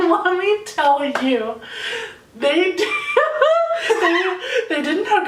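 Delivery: drawn-out build-up broken by laughter; cracking up. Real, not acted. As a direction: struggle to get the punchline out through giggles. Cracking up at the story's climax.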